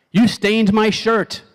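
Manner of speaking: high, slow, indignant accusation; mad. Acted. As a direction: accuse them, stretching each word. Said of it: "Obviously, this person's mad".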